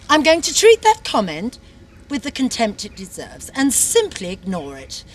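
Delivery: ordinary delivery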